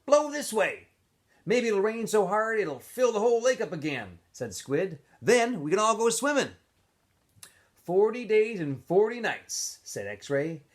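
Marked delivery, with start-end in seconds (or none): 0.0-4.2 higher, hopeful camper voice; voicing Squid. A narrator's reading. Squid to the boys, hoping for rain, in a higher voice. 5.2-6.5 higher, hopeful camper voice; voicing Squid. A narrator's reading. Squid to the boys, hoping for rain, in a higher voice. 7.8-9.8 higher, slower joking camper; drawn-out mock solemn joke; voicing X-Ray. A narrator's reading. X-Ray joking to the boys about the flood, higher and slower.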